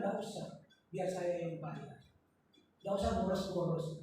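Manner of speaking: hushed, low mock-demure voice; very soft, low imitation of a modest refusal; soft-spoken girlfriend, imitated. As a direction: murmur it like a considerate sweetheart declining a gift. Wife's sweet courting-days refusal, retold.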